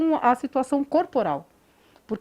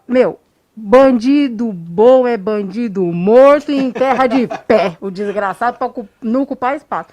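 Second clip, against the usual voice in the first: mock tough guy answer; 2 semitones lower, 5 dB louder than usual. Mock bad candidate answer to psychologist.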